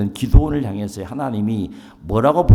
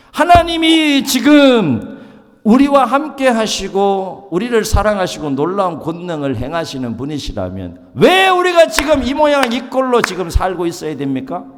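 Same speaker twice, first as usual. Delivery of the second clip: complaining Gideon; 7 semitones higher, 5 dB louder than usual. Retold or imitated. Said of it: "원망하고 불평하고 있는 거예요".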